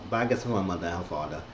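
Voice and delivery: ordinary talk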